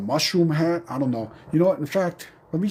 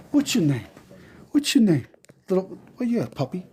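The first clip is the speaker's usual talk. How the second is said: puppy talk; 6 semitones higher than usual. Real, not acted. Cooing at the dog.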